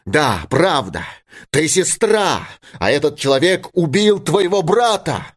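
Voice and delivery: insistent, pitch climbing high; heated father. A narrator's reading. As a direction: argue it at her, rising in heat. Father argues with daughter. (горячий упрёк отца, голос всё выше)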